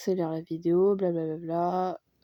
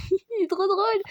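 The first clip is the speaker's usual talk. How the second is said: squeaky, much higher mock voice-over with a laugh; playful voice-over; 11 semitones higher than usual. Vlogger doing a playful voice-over on a BTS clip, much higher and squeaky. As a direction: pop into a silly cartoon narrator voice. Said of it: "J'aime trop faire des voix off comme ça".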